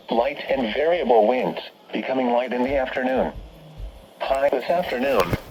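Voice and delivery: usual speaking voice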